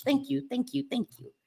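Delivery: high, soft, repeated thanks; little thank-yous. Real, not acted. Streamer thanking viewers for compliments, small high voice.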